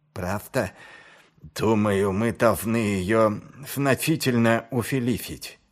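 "значительно увеличить" with f replacing the sibilants; lisping speech. Acted. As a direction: lisp through "значительно увеличить", hissing sounds turned to f. Niz speaks with hurt nose.

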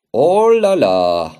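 long, swooping "oh la la"; drawn-out exclamation. Acted. Repairman reacting to the flooded floor, drawn-out exclamation.

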